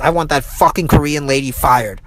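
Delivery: ordinary talk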